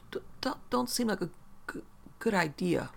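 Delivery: whispery, stuttered refusal; tearful stammer. A narrator's reading. Shaken woman declines an escort to the lobby, stammering weakly. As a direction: stumble on the first sounds, partly without voice.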